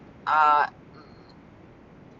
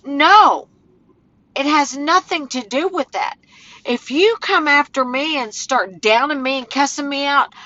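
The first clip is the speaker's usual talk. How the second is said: ordinary talk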